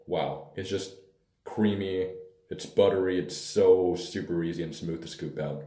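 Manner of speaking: ordinary talk, nothing marked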